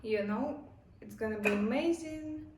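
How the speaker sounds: ordinary delivery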